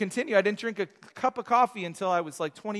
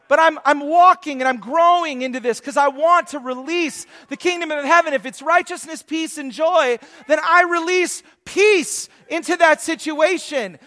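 loud, high, emphatic sermon delivery; full-force preaching; 7 semitones higher, 11 dB louder than usual. Real, not acted. Preacher charging the church to witness, emphatic preaching. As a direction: preach it out with punch.

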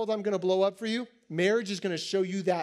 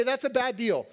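normal talking voice

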